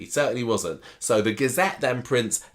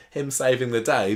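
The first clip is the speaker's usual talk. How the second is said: usual speaking voice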